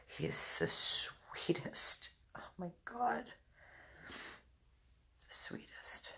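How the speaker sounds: breathy whisper, barely voiced. Real, not acted. Owner whispering over her sleeping dog, soft whisper. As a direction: hush so the sleeping dog stays asleep.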